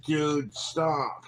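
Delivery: ordinary delivery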